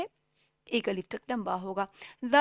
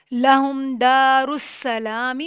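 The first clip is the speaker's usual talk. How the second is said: slow, louder melodic chant; slow Quran recitation; 11 dB louder, much slower than usual. Shown as a demonstration. Teacher reciting the verse slowly for students. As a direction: recite it long and full.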